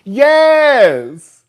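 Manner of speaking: loud, high, drawn-out cheer; emphatic agreement. Real, not acted. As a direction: call it out in total agreement. Emphatically agreeing with a viewer's comment.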